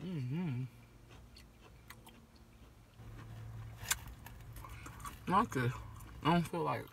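speaking with food in mouth; words slurred by chewing; talking while chewing. Real, not acted. Seen 0: bite taken at -1.0; jaw still working, mouth half-full early on.

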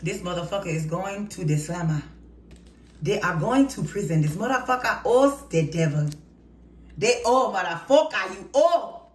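put-on ranting in a playful African accent. Tarot reader ranting about the karmic people, in a mock African accent. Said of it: "in my African voice".

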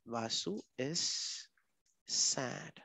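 very slow, hushed and low; quiet, drawn-out self-dictation; typing aloud. Real, not acted. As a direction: say each word softly as you type it. Reads aloud while typing label.

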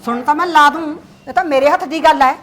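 loud, defiant retort; angry retort. Acted. Mother snapping back at her son, loud and defiant. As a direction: shout back that you'll make her listen.

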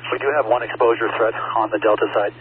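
loud, urgent radio report over noise; urgent firefighter radio. Acted. Engine officer radios arrival on scene.

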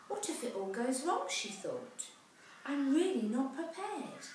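put-on lively rhyming read-aloud for children; storybook narration. A narrator's reading. Parent reading a rhyming picture book aloud, lively. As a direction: tell the rhyme brightly to a child.

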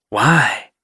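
put-on lightly rasped old she-cat; a short question with some rasp. A narrator's reading. Jay questions the visitor, rasping. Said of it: "Jay rasped".

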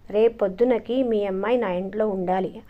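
ordinary delivery

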